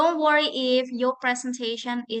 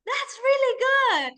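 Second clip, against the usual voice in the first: high-pitched, impressed praise; gushing praise; 9 semitones higher than usual. Real, not acted. Coach praising the first presenter, gushing. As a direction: let the pitch soar with approval.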